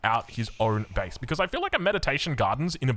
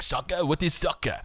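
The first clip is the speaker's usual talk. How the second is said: mock European accent, vaguely French; hammy, French-ish European accent; default European accent. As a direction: imitate a baffled European with a muddled accent. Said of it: "what accent was that right there?".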